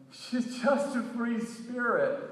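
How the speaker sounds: high call, slow; yelling. Retold or imitated. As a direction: shout after the leaving bus. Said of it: "I'm yelling".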